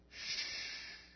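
soft unvoiced shush; a breathy voiceless shh; hushing shh. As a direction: hush them like pride would. Pride hushing the sinner.

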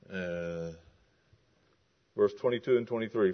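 normal talking voice

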